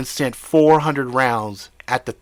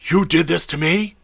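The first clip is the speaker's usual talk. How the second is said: loud, higher furious bark; 6 semitones higher, 5 dB louder than usual. Acted. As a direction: bark it at him. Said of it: "barked Jimmy".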